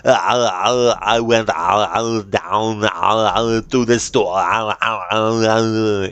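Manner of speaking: hammed-up Arnie accent with drawn-out wailing between words; humorous Arnie accent. Shown as a demonstration. Streamer parodying how people do Arnie, hammed-up accent. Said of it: "humorous version of Arnie's accent".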